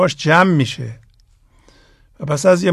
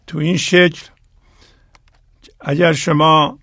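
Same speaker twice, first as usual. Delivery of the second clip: ordinary talk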